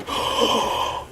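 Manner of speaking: big theatrical gasp; sharp acted intake of breath; mock excited gasp. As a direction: gasp as if thrilled by an invitation. Acts out own eager reaction.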